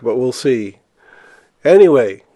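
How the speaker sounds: normal talking voice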